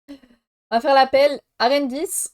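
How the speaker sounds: mock roll-call voice, names called out; teacher taking roll. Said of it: "Ma prof dit en début de cours".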